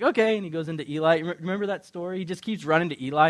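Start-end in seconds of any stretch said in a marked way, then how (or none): 0.0-0.4 high, loud chirp; bright loud boyish reply; eager young Samuel. Retold or imitated. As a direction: answer instantly like an obedient kid. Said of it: "And Samuel's like".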